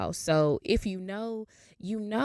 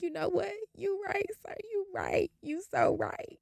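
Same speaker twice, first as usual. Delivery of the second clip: high, soft imitation of crying; mock tearful; 13 semitones higher, 8 dB quieter than usual. Crying to the man at the DMV, mock tearful. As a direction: squeak it out as if boohooing. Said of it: "I just started boohoo crying".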